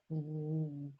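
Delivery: a low, soft bass ooh; imitating basses. Mimicking the choir basses, low soft ooh. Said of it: "all of the basses are like".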